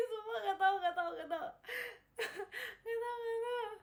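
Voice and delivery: giggly flustered refusal. Real, not acted. Flustered refusing a rapper name.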